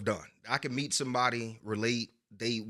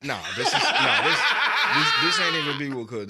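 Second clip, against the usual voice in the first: laughing; 8 semitones higher, 10 dB louder than usual. Real, not acted. Laughing refusal.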